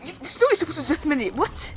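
usual speaking voice

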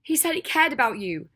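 loud, high, anguished accusation; devastated rage. A narrator's reading. Ginny accuses Draco in front of her friend, in devastated rage. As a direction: build in fury as if close to breaking. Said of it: "shaking with devastated rage".

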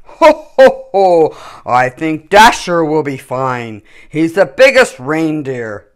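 hearty Father Christmas choosing his team; jolly Santa. A narrator's reading. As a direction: boom it out like a merry Santa. Santa's voice in story.

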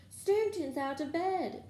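high, slow, drawn-out call; bellowing. A narrator's reading. Poltergeist raising the alarm on students, high bellow. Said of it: "Peeves bellowed".

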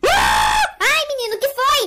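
startled shout. Acted. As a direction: jump and shout in fright. Startled in the dark. (grito assustado)

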